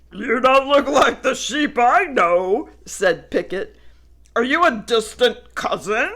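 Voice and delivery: loud, playful questions; cheeky pup; friendly dog voice. Acted. Picket teasing.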